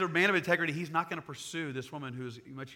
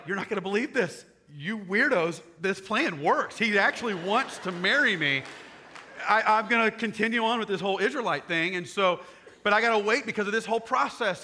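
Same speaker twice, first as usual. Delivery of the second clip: loud, high, excited rush of news; excited gush; 5 semitones higher, 6 dB louder than usual. Acted. Ruth bursting with news to Naomi, excited and loud. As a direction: blurt it out thrilled.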